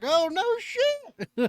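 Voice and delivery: high, slow, drawn-out "no shit" of disbelief; mock amazed southerner. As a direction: stretch it out like a stunned country local. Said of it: "And they're like".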